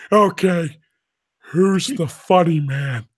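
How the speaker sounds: slow, loud sergeant demand; Technical Sergeant Ramscock. Retold or imitated. Imitating the T I confronting the recruits at attention, slow menace. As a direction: draw it out as the menacing drill instructor.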